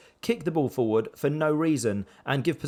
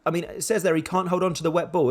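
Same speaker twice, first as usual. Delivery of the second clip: usual speaking voice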